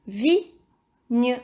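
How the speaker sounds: vigne. split into vi and gne; measured vigne., final gne fully sounded; syllable by syllable. Shown as a demonstration. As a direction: say "vigne." as two separate beats. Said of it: "à la première ou à la deuxième syllabe".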